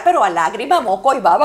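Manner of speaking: loud, sobbing retelling; crying hard. Real, not acted. Recalling the drug dealer's kindness, crying loudly. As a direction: break down crying as you tell it. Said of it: "ahí empecé a llorar".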